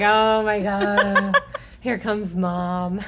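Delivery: mock groan. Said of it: "They're like".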